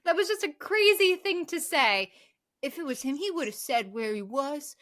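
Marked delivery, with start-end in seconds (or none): none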